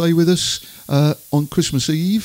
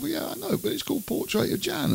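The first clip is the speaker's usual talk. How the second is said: much higher and much softer, coaxing; innocent husband; 8 dB quieter than usual. Retold or imitated. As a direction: plead sweetly and innocently. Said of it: "And I said".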